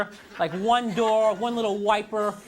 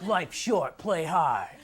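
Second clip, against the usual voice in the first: mock tough jock; 5 semitones lower, much slower than usual. Dork reading his sports shirt.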